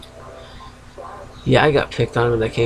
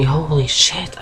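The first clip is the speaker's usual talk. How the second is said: ordinary talk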